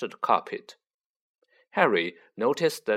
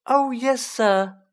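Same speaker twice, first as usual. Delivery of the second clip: high, loud, earnest elf; earnest Dobby; 13 semitones higher, 6 dB louder, slower than usual. A narrator's reading. Elf answering Harry, earnest and high-pitched. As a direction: answer eagerly in a squeaky elf voice. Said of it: "said Dobby earnestly".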